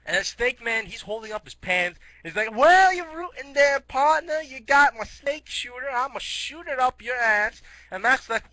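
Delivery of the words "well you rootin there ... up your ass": high, loud cartoon cowboy; Western sheriff. Snake Man the sheriff threatening his prisoner, hammed-up cowboy voice. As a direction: play a hammed-up Wild West lawman.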